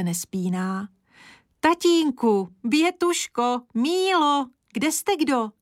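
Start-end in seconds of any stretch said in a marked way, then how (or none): 1.6-5.5 high, drawn calls to the family; calling out. A narrator's reading. Woman summons her family to see, calling out. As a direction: call each one in a raised voice.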